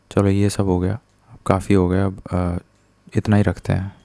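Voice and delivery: usual speaking voice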